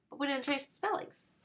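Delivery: usual speaking voice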